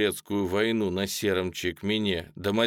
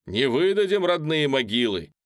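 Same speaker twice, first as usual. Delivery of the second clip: shouting; 7 semitones higher, 5 dB louder than usual. A narrator's reading. Crowd voice shouts from rally.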